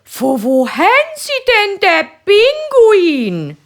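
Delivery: passer-by asking the man about his penguin; curious woman. Acted. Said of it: "fragt die Frau".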